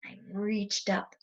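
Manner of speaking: slow hushed creep; sneaky hush. Shown as a demonstration. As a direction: tiptoe the words. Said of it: "very slowly".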